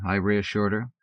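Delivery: ordinary delivery, nothing marked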